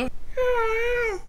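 very high falsetto character voice; squeaky puppet Sheila. Acted. Sheila answering about staying safe, high squeaky voice.

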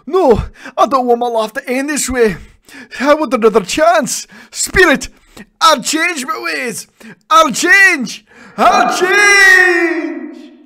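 high, loud, rising to a frantic shout; desperate pleading. Acted. Scrooge hanging in his grave, begging in a high frantic shout. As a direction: beg for another chance. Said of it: "he exclaimed".

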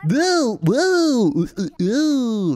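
high, fast chipmunk-style woo-hoos; squeaky sped-up Sim chatter; sped-up Sim noises. Retold or imitated. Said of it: "That's what they sound like when it's sped up".

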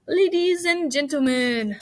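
loudspeaker announcer. A narrator's reading. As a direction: open grandly to the whole crowd. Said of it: "said the loudspeaker".

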